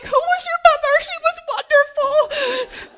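very high, loud, laughing joke; laughing self-praise. Real, not acted. Mother joking about her own parenting, high laughing exclamation. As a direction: squeal it through laughter.

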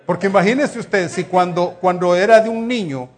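laughing loudly. Real, not acted. Laughing retelling to congregation.

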